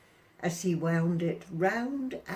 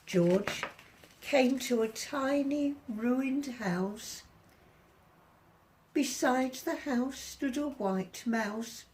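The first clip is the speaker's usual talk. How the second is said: ordinary delivery, nothing marked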